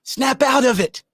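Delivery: high, slow, forceful plea; urgent. Real, not acted. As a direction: shake them awake. Urging viewers.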